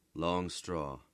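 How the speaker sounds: ordinary delivery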